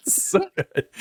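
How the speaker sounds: high, laughing praise. Real, not acted. Friend praising the skit while still laughing. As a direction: squeal it through a laugh.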